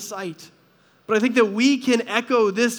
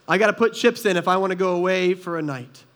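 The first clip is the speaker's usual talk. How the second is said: ordinary talk, nothing marked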